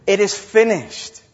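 loud ringing cry of triumph; great cry. A narrator's reading. Jesus on the cross crying out in victory. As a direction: cry it out to the whole world. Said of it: "lets out the greatest cry".

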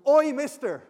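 loud, high, abrupt call to a stranger; street heckler's shout. Acted. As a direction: holler rudely at someone across the street. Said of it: "someone goes".

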